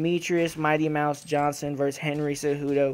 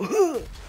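imitated gasp; 13 semitones higher than usual. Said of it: "Henry Cejudo go".

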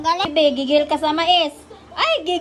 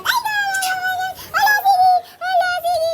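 very high, squealed fussing; 12 semitones higher, 3 dB louder than usual. Real, not acted. Fussing over the baby, excited squeal.

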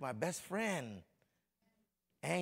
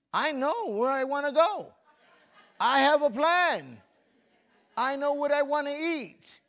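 much higher, louder preached declaration; preaching shout; 11 semitones higher, 13 dB louder than usual. Acted. Preacher to the congregation, a much higher, louder declaration. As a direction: proclaim you have a plan.

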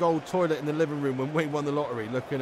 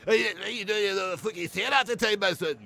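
a lightly put-on strong Glaswegian accent; half-hearted Scottish Ramsey Nesbitt impression, a bit higher; mock Scottish accent quoting the sketch show; 4 semitones higher than usual. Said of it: "I know this Scottish accent, it's strong".

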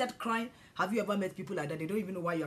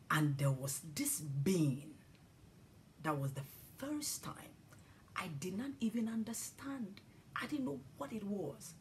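awed whisper; 7 dB quieter than usual. Real, not acted. Recalling awestruck vision.

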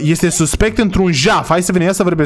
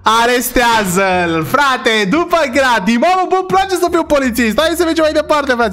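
excited find; 6 semitones higher than usual. Real, not acted. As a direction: burst out over the discovery. Finds stolen phone on suspect. (exclamație triumfătoare, mai sus)

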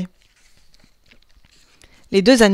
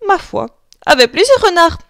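ordinary delivery

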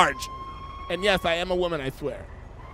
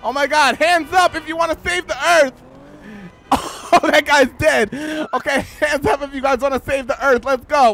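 excited rallying; 6 semitones higher, 5 dB louder than usual. Acted. Rallying crowd to save earth.

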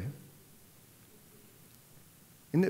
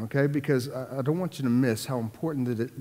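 normal talking voice

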